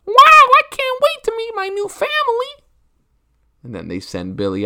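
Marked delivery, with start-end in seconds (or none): 0.0-2.7 put-on squeaky excited child, very high and loud; little kid voice. Three-year-old Billy thrilled about new family, squeaky high. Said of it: "And Billy's like".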